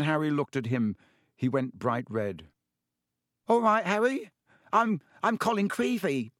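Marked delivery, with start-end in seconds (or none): 3.5-6.3 put-on high, eager boy voice, breathless; breathless excited schoolboy; breathless Colin. A narrator's reading. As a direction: rush it out like an awestruck first-year. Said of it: "he said breathlessly".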